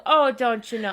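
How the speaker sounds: loud mimicked Minnesotan accent; exaggerated Minnesotan; mock Minnesotan accent. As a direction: mimic the Minnesotan catchphrase loudly. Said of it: "I don't go".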